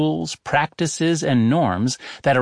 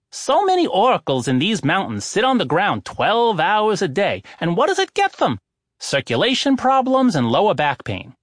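a lightly higher-voiced old oracle, self-assured; oracle's voice; 7 semitones higher than usual. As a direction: play the smug oracle defending his chair. Oracle Misoponos lectures visitors.

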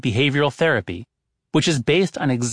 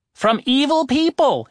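higher, impatient answer; exasperated; 12 semitones higher, 3 dB louder than usual. A narrator's reading. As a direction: answer as if the answer were obvious. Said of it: "clearly exasperated".

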